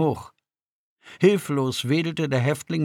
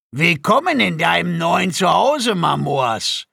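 loud, higher-pitched mock welcome; jeering; 5 semitones higher, 5 dB louder than usual. A narrator's reading. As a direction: taunt the new prisoner with a gloating welcome. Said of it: "höhnte der Wächter". (lauter, höhnischer Willkommensgruß)

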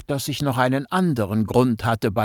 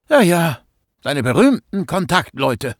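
snapping back; 5 semitones higher, 3 dB louder than usual. Acted. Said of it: "blaffte ich zurück".

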